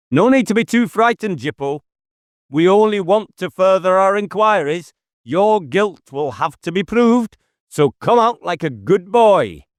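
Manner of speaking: sarcastic reassurance to a hidden suspect; mock coaxing. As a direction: coax him out like a naughty child.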